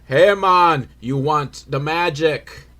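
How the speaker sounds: loud opening, drawn-out fake Jamaican accent; mystery man's badly faked Jamaican accent.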